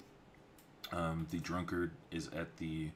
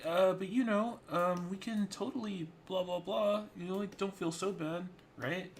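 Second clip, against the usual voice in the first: put-on a much higher, louder girlish voice; high younger sister voice; 6 dB louder than usual. Younger sister cheering up her older sister, high and girlish.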